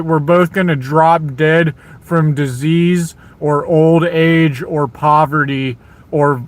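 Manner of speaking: ordinary delivery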